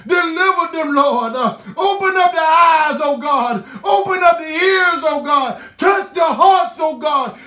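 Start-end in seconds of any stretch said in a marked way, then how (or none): none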